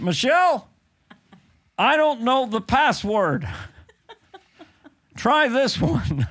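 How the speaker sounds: high, slow presidential impression; Barack Obama. President asking his wife about a password, impersonated. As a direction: call out slowly to your wife as the president. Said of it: "this is Barack Obama".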